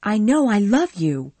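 loud, high passionate cry; cried out. Acted. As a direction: blurt out her love. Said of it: "cried Scarlett".

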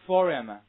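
"Forum" for "for him", h dropped and words joined; loud model of "Forum", meaning for him without the h; linking through h. Shown as a demonstration. Teacher drilling a phrase confused with forum, loud linked reading. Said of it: "read after me".

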